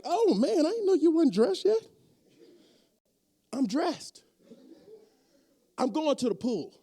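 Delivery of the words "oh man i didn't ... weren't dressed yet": higher, louder fake-startled voice; mock shocked friend. Friend walking in on a shirtless man, hammed-up startled. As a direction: play a friend caught off guard.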